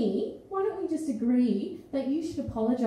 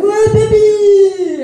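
slow, drawn-out shouted leer, much louder and higher; catcaller yell; 7 semitones higher, 14 dB louder, much slower than usual. Retold or imitated. Catcaller yelling at a woman walking past, drawn-out shout. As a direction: holler it out a car window like a leering catcaller.

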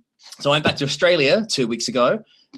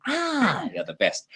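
usual speaking voice

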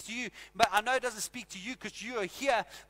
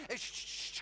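ordinary talk, nothing marked

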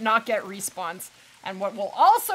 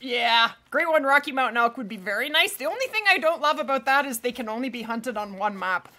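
usual speaking voice

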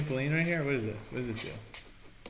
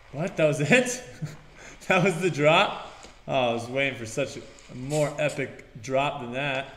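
usual speaking voice

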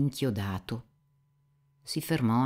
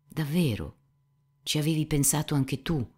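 mildly surprised, higher-pitched question; startled exclamation; 6 semitones higher than usual. A narrator's reading. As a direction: exclaim it with a touch of disbelief. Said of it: "Esclamò". (esclamazione stupita, contenuta)

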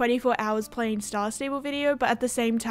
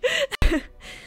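1 amused laugh; 13 semitones higher than usual. Real, not acted. Laughs at own accent.

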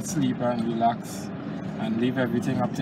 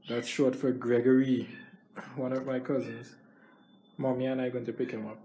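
ordinary talk

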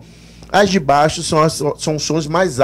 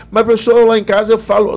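mock student objection; 7 semitones higher, faster than usual.

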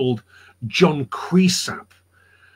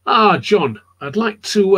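higher, louder commander's greeting; George Washington; 5 semitones higher, 4 dB louder, slower than usual. Retold or imitated. Commander greeting John at his headquarters, hailing. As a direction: hail a summoned officer, lightly acted. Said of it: "George Washington summoned John Creasap to his headquarters".

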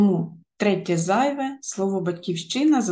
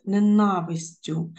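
"ненавистю" with the НА syllable stressed; stress on second syllable. Shown as a demonstration. Teacher giving correct stress, "ненавистю" stressed on second syllable. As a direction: punch the first А in "ненавистю". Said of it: "другий склад".

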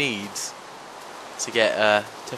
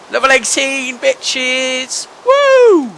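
loud, high victory whoop; triumphant cheer; 11 semitones higher, 12 dB louder, much slower than usual. Real, not acted. Celebrating hitting level eighteen, loud triumphant cheer. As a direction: crow over the win.

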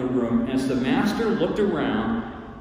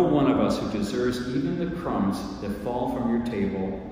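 low, measured praying voice; prayer; 4 semitones lower, 4 dB quieter than usual. Real, not acted. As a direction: pray humbly and softly for understanding. Leads a closing prayer.

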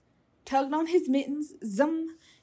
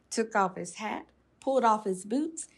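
usual speaking voice

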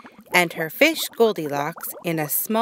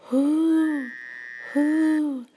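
owl hoot; 9 semitones higher than usual. A narrator's reading. Owl hooting.

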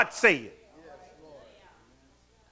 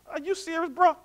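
high, soft incredulous scoff; attitude; 7 semitones higher, 5 dB quieter than usual. Acted. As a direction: sound put out and disbelieving. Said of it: "can you imagine God's attitude?".

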